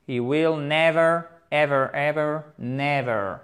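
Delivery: NEVER stressed on NE, slow and careful; slow model of NEVER, "NEV-er"; first-syllable stress. Shown as a demonstration. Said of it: "Accent tonique sur la première syllabe".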